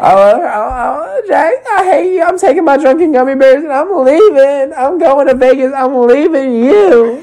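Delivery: very high mock-crying whine; Brittany's tearful rant. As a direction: wail the breakup in a hammed-up high voice. Mimics Brittany leaving Jax.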